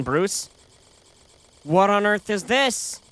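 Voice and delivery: ordinary talk, nothing marked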